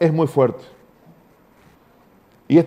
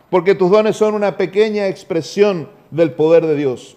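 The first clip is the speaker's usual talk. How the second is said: ordinary talk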